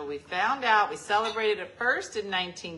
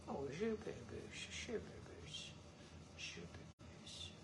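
cooing pet names; 5 semitones lower, 17 dB quieter than usual. Real, not acted. Cooing to baby.

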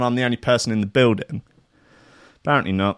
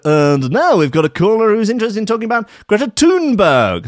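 higher, announcing like a talk radio presenter; mock talk radio host; 8 semitones higher, 3 dB louder than usual. DJ mocking a talk radio host, announcer voice. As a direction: sends up a pompous radio host. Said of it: "It's like".

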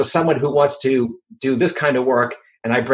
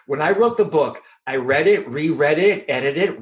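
normal talking voice

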